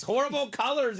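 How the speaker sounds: imitating Jaime. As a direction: sneer at the colors in a put-on voice. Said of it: "he says".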